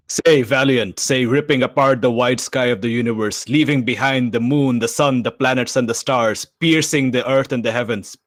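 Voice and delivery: high, loud, booming proclamation of a god speaking through him; possessed divine voice. Acted. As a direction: declaim it like a deity rising. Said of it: "he speaks through the water in his throat in a different voice".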